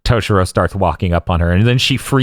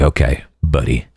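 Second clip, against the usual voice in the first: imitating Byakuya; 7 semitones lower than usual. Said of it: "And he's like".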